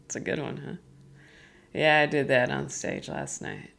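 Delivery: normal talking voice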